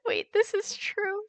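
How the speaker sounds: very high, slow, squeaky with laughter; real helpless amusement pushing the pitch up; laughing disbelief. Real, not acted. Streamer cracks up at a relatable meme, laughing.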